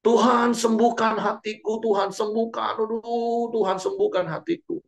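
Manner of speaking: high, whining plea for healing; mock a hurt believer begging God; hammed-up whiny prayer.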